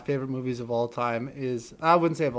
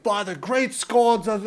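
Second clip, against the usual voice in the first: medieval guy; 6 semitones higher, 3 dB louder, slower than usual. Retold or imitated. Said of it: "he talks like the medieval guy".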